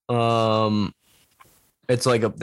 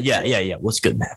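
put-on frat boy voice; 2 semitones lower than usual. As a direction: do a dumb frat bro. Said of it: "frat boy".

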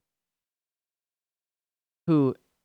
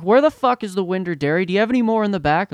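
higher, quickening mock rant; irate customer demand; 7 semitones higher, 4 dB louder, faster than usual. Rich dude demanding milk from the worker, irate. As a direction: imitate a shopper demanding answers, rushing the last question.

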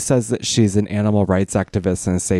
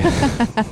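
short high giggle; high laugh. Real, not acted. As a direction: burst of high-pitched laughter. Laughing at Joe's denial.

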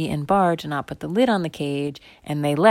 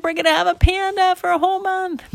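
put-on high, excited child voices; thrilled kids; 4 dB louder than usual. Kids celebrating a month with panda, thrilled. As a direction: squeal the good news.

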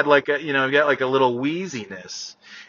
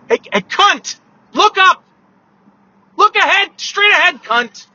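shouting at driver; 8 dB louder than usual. Real, not acted.

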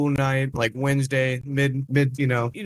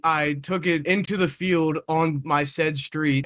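normal talking voice

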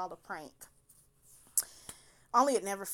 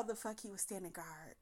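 hushed, breathy punchline; whisper; 12 dB quieter than usual. Real, not acted. Telling the audience how he stood guard, whispered. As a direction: drop to a whisper for the payoff.

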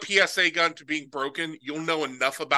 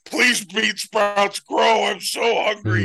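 pleading to sprouts; 7 semitones higher, 5 dB louder, slower than usual. Pretend. Mock plea to bean sprouts.

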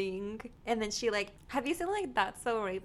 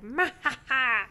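loud, slow evil cackle; evil laugh; 3 semitones higher, 12 dB louder, slower than usual. Retold or imitated. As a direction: cackle it like a villain. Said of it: "my laugh out loud is weird".

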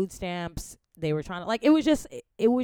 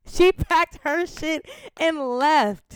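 slow, loud and much higher, every word stretched; emphatic, drawn out; 11 semitones higher, 8 dB louder, much slower than usual. Real, not acted. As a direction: spell it out slowly in disbelief. Repeats the shock for emphasis.

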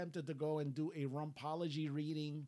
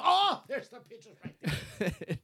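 excited laughing; 7 semitones higher, 10 dB louder than usual. Real, not acted. Excited at pictures.

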